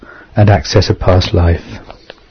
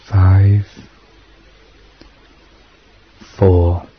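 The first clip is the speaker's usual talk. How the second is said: guided meditation. Acted. Leads a hypnosis meditation.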